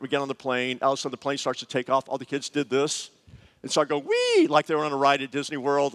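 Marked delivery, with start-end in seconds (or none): 4.0-4.5 high squealing wee; gleeful high wee; mock kids' cheer. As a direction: squeal it like kids on a ride. Kids cheering at takeoff.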